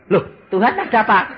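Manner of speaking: usual speaking voice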